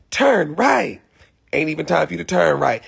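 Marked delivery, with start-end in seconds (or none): 0.1-1.0 higher mock GPS command; the other GPS voice. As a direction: mimic the usual GPS lady, pitched up. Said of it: "the other one, she be like".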